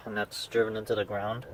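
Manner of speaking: usual speaking voice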